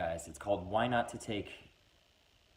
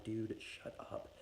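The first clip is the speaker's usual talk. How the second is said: slow, breathy whisper, much softer; whispered hush; 10 dB quieter, much slower than usual. Acted. Narrator hushing the whiskey in his throat, slow whisper. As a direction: hiss it back so friends don't hear.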